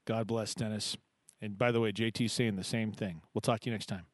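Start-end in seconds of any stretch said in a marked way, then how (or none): none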